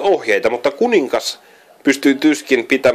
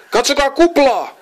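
very high, loud shriek; 3 dB louder than usual. A narrator's reading. Joni shrieks at his brothers to look at Bubble. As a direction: shriek it in alarm. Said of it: "kirkaisi Joni".

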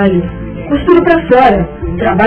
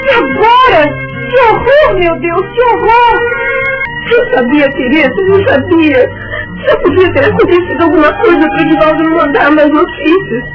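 crying out in grief; high, tearful, despairing cries; sobbing; 6 semitones higher than usual. Acted. Widow learns husband died.

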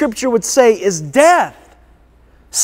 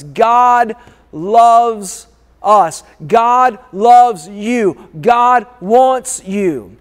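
slow emphatic repetition; much slower than usual. Acted. Preacher repeating for emphasis.

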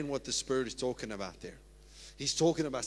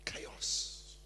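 whispered chaos; 5 semitones lower, 8 dB quieter than usual. Acted. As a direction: breathe the word out in a whisper. Describing Pentecost chaos.

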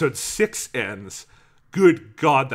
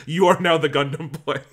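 mock grand speech; 4 semitones higher, 6 dB louder than usual. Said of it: "He doesn't go like that".

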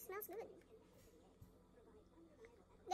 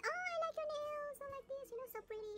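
gushing compliment; 8 semitones higher than usual. Retold or imitated. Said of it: "I said".

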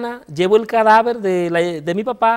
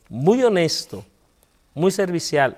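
normal talking voice

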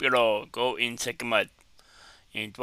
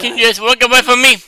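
higher, loud, hurried mock plea; exasperated mimicry; 9 semitones higher, 13 dB louder, faster than usual. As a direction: echo a fed-up woman, loud and fast. Echoing the clip's fed-up woman.

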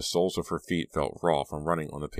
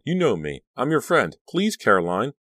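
high, desperate appeal; louder acted pleading; crying out; 8 semitones higher, 5 dB louder than usual. A narrator's reading. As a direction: plead with a friend turned threatening. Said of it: "Danielle cried".

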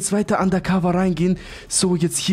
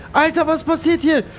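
ordinary talk

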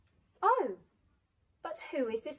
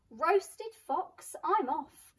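high, loud panicked squeak; a startled yelp, then bolt; alarmed yelp; 6 semitones higher, 7 dB louder, much slower than usual. Acted. Fox panics and flees.

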